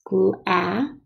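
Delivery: "Gla-" cut off after a held short A; stressed A sound. Shown as a demonstration. Teacher letting pupils hear the A, sounded out. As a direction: stretch "Gla-" and stop on the vowel. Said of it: "Listen to the sound of the A".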